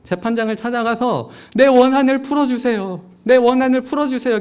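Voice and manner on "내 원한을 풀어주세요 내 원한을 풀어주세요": loud, high repeated plea; pleading. Retold or imitated. Widow pleading with the judge, loud insistent begging. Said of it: "계속 간청합니다".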